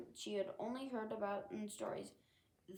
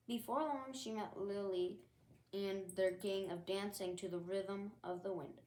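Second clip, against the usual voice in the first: plain reading voice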